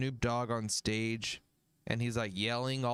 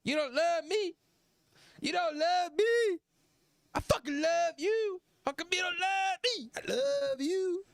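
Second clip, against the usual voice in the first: much higher, louder wailing heartbroken [name]; [name]'s heartbreak voice; 3 dB louder than usual. Retold or imitated. [name] pleading with the crowd, high heartbroken wail. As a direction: wail it like a jilted lover. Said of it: "this is his heartbreak voice".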